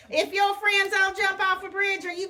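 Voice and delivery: high, mocking parent scold; a hint of a scolding mother. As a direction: play the nagging parent.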